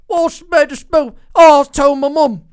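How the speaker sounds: loud, high mock British accent; exaggerated British accent, belted out; British TV impression. Parroting British TV at once, loud mock accent. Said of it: "I'd like to do impressions. If I hear it on T V".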